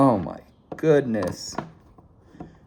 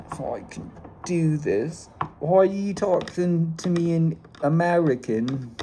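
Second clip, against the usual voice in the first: put-on British accent; 2 semitones higher than usual. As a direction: keep up the British shtick. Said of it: "my British accent".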